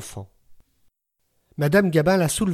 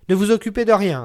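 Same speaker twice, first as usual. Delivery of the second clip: louder, higher, gruffly taking charge; gruff authority; 5 semitones higher, 6 dB louder than usual. A narrator's reading. Old neighbour taking charge of the room, gruff and commanding. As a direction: take over the room, brusque and bossy. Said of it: "avec une autorité bourrue".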